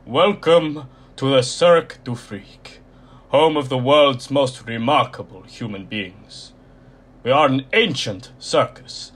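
loud, croaky ringmaster announcement; croaky showman voice. A narrator's reading. Mister Tall welcomes the audience to his freak show, loud and croaky. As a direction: proclaim it like an ancient circus master, loud. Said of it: "his voice was low and croaky".

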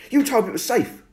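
high, loud accusing shout. Acted. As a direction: yell it at him, betrayed. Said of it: "I shouted".

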